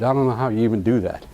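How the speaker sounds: ordinary delivery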